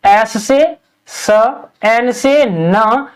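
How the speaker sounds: each letter-sound pair drawn out; walk through the letters slowly; slow letter demo. Shown as a demonstration. Teacher slowly matching letters to Hindi sounds. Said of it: "यानी इसी तरह".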